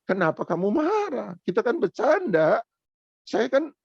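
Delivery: high, surprised wait-wait plea; startled protest. Retold or imitated. A youth leader startled by the boy's anger, surprised. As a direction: pitch up in mild disbelief. Preacher retells calming the boy.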